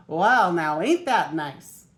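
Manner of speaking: a hint of a folksy street sweeper admiring the ducks. A narrator's reading. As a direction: street sweeper admiring the ducklings, lightly voiced.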